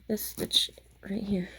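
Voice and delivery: soft whispered aside. Real, not acted. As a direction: whisper it to the camera. Unvoiced close-up aside.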